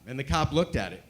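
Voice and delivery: usual speaking voice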